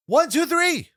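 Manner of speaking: mock referee pin count. Calling the pinfall count.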